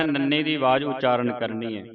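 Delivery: ordinary delivery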